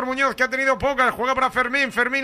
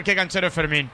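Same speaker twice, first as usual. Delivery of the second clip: normal talking voice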